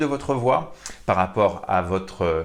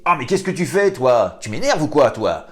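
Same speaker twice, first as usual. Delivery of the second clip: louder, higher irritated outburst; annoyed anger; 6 semitones higher, 7 dB louder than usual. Shown as a demonstration. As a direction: snap at them, fed up. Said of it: "Ensuite, la colère, quand on est agacé". (colère agacée, plus forte et plus aiguë)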